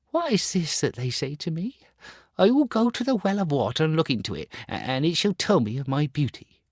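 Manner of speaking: put-on high, quick, puzzled boy's voice. Star Child puzzled by his playmates' taunts, high. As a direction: wonder aloud, hurrying to the well.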